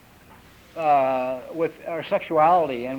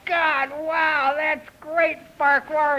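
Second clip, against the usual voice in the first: high-pitched, delighted mother voice; gushing mother; 10 semitones higher, much slower than usual. Acted. Mother praising little Farquhar, high gushing delight. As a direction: gush over your toddler's little feat. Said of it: "his mother just goes".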